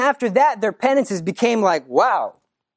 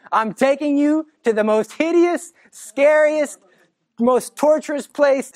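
ominous Mary; 6 semitones higher, slower than usual. Acted. Mary voiced describing hell.

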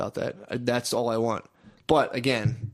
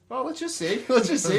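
sly hopeful musing; 10 semitones higher than usual. Retold or imitated. Retold hopeful thought at park.

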